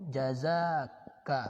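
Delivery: "Jazaka" drawn out very slowly, syllable by syllable; slow drilled repeat. Shown as a demonstration. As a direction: spell out "Jazaka" slowly for the class. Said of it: "Seperti jazakallah itu kan?". (ucapkan "Jazaka" dengan sangat pelan)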